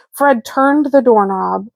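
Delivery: plain reading voice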